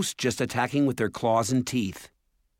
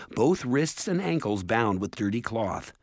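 ordinary delivery

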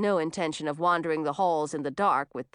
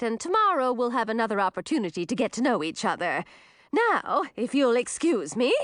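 put-on sweetly bossy old witch; insistent witch voice; 4 semitones higher than usual. Witch insists guests stay.

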